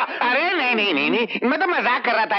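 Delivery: high, pleading repeated denials; flustered backtracking. Acted. Master stops servant quitting, flustered backtracking.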